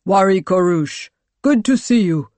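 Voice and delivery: loud, hearty greeting from a commander; booming baritone. A narrator's reading. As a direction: boom out a welcome. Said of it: "his voice a booming baritone".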